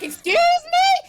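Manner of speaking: high, loud, slow indignant squeal; shrieked, drawn-out indignant question; outraged excuse me. Retold or imitated. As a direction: squeal it out in disbelief, stretched. Wife's outraged reaction, very high loud.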